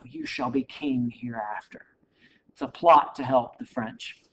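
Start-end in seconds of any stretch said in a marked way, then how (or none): 0.0-1.9 soft, unvoiced whisper. Napoleon tempting Jefferson, whispered secretly. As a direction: breathe it into his ear. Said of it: "Napoleon is whispering into Jefferson's ear".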